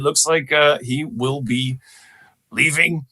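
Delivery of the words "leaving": ordinary delivery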